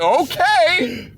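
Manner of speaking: loud, high, drawn-out "Okay"; retold nervous okay at speed. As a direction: hang on while the driver is thrashing it. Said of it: "and I'm like".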